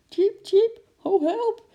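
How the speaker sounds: high, loud startled squeak; frightened squirrel squeal. A narrator's reading. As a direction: squeal for help in sudden fright. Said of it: "schrok zich suf".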